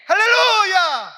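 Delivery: long, slow, high crying shout; hallelujah shout. Real, not acted. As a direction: cry out the hallelujah to the rafters. (목청껏 길게 외치는 할렐루야)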